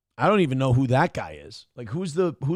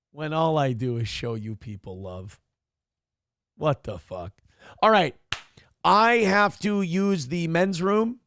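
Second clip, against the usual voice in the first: ordinary talk, nothing marked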